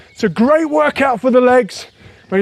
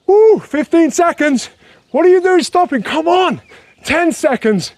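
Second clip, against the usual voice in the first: excited coaching; 7 semitones higher than usual. Real, not acted. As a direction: fire them up for the last seconds. Fitness coach pushing final seconds.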